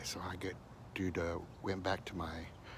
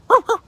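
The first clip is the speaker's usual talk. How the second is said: dog bark; 21 dB louder than usual. Retold or imitated. Dog barking again.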